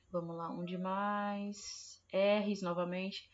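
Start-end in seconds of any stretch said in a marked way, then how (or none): none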